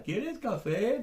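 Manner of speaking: "quieres café" high with a swooping rise; exaggerated question intonation. Shown as a demonstration. Teacher overdoes a Spanish question, "quieres café" sharply rising. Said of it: "No, exagero".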